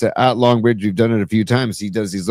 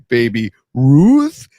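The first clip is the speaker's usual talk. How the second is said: Sloth voice; 4 dB louder than usual. Retold or imitated. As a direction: drag it out like Sloth from Goonies. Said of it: "shout out Sloth from Goonies".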